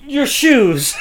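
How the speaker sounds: high, loud punchline after a halt; imitated random flattery. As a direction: land the absurd compliment with a laugh. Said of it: "beyond flattery to something random".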